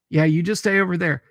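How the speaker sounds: ordinary talk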